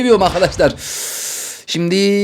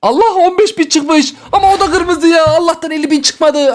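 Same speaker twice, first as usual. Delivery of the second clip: relieved outcry; 8 semitones higher than usual. Real, not acted. Relief box not fifty thousand.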